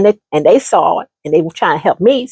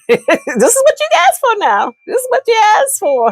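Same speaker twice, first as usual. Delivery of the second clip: much higher, laughing through it; mocking gloat; 12 semitones higher than usual. Teasing the man about the kids, high and laughing. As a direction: rub it in with glee.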